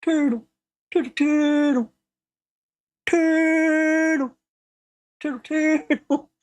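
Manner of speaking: high silly repeated chant; high squeaky movie voice. Retold or imitated. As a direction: squeak it like a goofy film character. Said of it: "he starts going".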